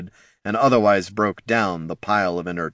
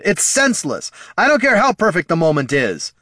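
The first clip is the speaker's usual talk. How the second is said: high, heated outburst; shouted protest; 10 semitones higher, 5 dB louder than usual. A narrator's reading. Bard arguing with wounded friend, shouted protest. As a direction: protest it at the top of your voice. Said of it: "shouted the bard".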